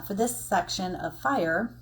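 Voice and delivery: usual speaking voice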